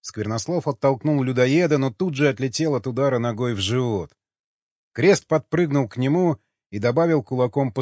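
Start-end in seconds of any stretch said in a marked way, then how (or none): none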